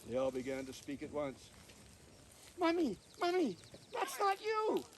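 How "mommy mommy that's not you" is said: much higher squeaky nestling voice; baby bird voice. Acted. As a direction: chirp it like a tiny fledgling. Baby ravens chatter at Molly.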